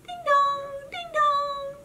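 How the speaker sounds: high sung doorbell; high sing-song ding dong; doorbell chime. Acted. Doorbell rings.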